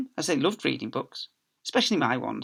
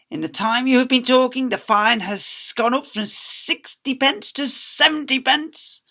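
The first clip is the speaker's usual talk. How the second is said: higher, slow, drawn-out pence; gloating librarian; 11 semitones higher, 7 dB louder than usual. Acted. Miss Spick raising the fine on the talking girl, gloating slowly. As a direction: savour each penny.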